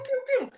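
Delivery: very high, playful pew-pew; cartoonish high-pitched spurts; squirting noises. Shown as a demonstration. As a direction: imitate little squirts in a squeaky pitch. Imitates squirts of love drugs.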